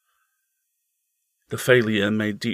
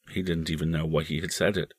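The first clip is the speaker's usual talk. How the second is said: usual speaking voice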